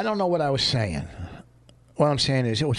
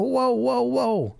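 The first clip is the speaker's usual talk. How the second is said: higher-pitched run of whoas; retold protest; 7 semitones higher, much slower than usual. Retelling his objection to the owner, protesting. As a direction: halt someone with raised, protesting whoas.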